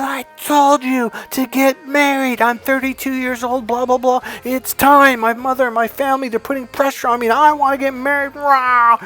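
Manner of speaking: high, strained whiny imitation; mocking rant. Client protesting family pressure to marry, mocking rant. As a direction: rattle off his excuses in a high voice.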